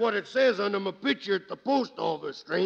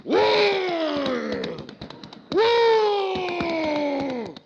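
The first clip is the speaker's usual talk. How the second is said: yelling at horse; 6 semitones higher, much slower than usual. Acted. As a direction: bellow at the horse to stop. Cowboy yelling at his horse.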